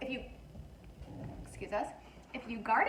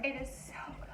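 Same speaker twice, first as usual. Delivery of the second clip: whispering; 3 semitones higher, 12 dB quieter, faster than usual. Real, not acted. Tasting, hushed delight.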